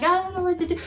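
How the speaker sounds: high-pitched imitation of helpless panic; freaking out. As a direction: act out a flustered neighbor. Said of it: "just freaking out and just being like".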